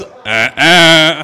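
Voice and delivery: a laughing, loud "uh, uh"; mock refusal, laughing it out; laughing grunt. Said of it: "Kathy says".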